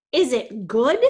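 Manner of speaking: loud, emphatic "good" swooping upward; incredulous echo. A narrator's reading. Alec echoes Jason's question about Hatchet, loudly incredulous. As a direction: throw the question back, "good" punched and rising.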